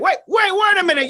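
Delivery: high, shouted double-take; startled wait-a-minute; sudden realization. Acted. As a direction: stop everything, you just caught him. Catches a trick with handcuffs.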